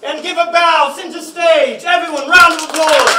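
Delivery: delighted showman's call; excited cheering. Acted. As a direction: whip the crowd into applause. Host celebrates finale with audience.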